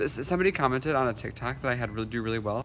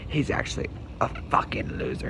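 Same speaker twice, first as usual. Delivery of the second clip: whisper; 4 dB quieter than usual. Retold or imitated. Quoting a troll's comment.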